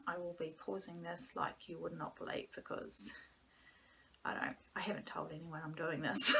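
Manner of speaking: hushed secretive whisper. Real, not acted. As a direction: keep it low, nobody must hear. Secret podcast, family might hear.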